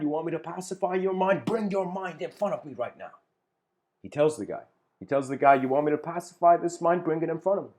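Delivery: a lightly voiced Bodhidharma demanding to see the mind; challenging Zen master.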